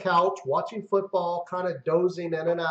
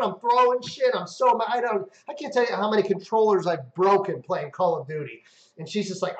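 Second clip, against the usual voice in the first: ordinary talk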